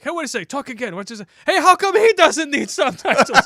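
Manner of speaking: a hammed-up thick accent asking in disbelief; an over-the-top Middle Eastern accent, mock puzzled; thick foreign accent. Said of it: "with a really thick accent".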